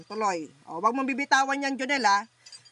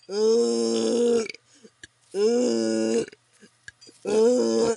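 loud groaning grunts; 6 dB louder, much slower than usual. Real, not acted. Healer grunting loudly over the patient in trance. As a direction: push out each grunt from the chest.

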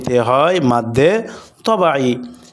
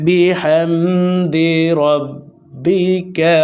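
slow, melodic verse recitation; measured tajweed recitation; Quranic recitation; 2 semitones higher, much slower than usual. Shown as a demonstration. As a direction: recite the verse in one flowing chant.

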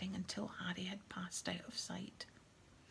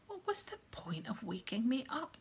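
mildly grumpy, raised pitch; grumpy call; 4 semitones higher than usual. A narrator's reading. Woken portrait lady calling after boy, grumpy. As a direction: complain after someone walking off. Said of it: "called grumpily after him".

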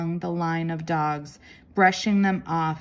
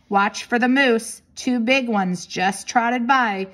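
higher, louder called warning; shouted warning; 5 semitones higher, 5 dB louder than usual. A narrator's reading. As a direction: call it out across a distance. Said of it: "He raised a hand and shouted".

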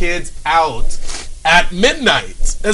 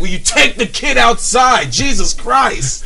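angry yell; 6 semitones higher, slower than usual. Retold or imitated. Lady in the theater yells at the mom. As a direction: snap at the mom to take the kid out. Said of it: "lady is finally like".

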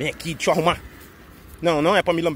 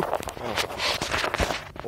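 whisper; 7 dB quieter, much slower than usual. Real, not acted. Finds dogs hidden nearby.

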